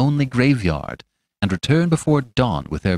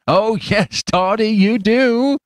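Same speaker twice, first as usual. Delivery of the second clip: high, drawn-out teasing; taunting stranger; 11 semitones higher, 4 dB louder, much slower than usual. A narrator's reading. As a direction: needle him slowly, pitched far up.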